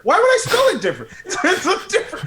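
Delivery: high, fast, laughing exasperation; cracking up in disbelief. Real, not acted. Friend laughing about people misspelling his name.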